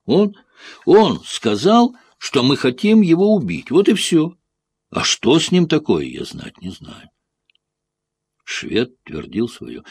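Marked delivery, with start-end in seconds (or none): 0.1-7.1 put-on a cornered boy pleading his innocence; pitched much higher, straining with desperation; agitated, desperate. A narrator's reading. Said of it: "в отчаянии".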